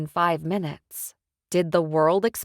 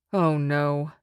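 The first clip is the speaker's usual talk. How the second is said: usual speaking voice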